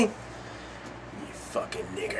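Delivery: whispered, slow, menacing slur; hissed slur. Retold or imitated. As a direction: breathe the slur out slow and nasty. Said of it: "what this motherfucker said to me".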